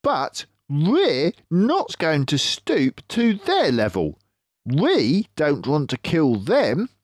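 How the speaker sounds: a drawn-out, knowing Mister Fox. Acted. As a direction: clever fox leader, pleased with himself.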